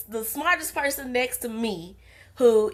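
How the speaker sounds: ordinary talk, nothing marked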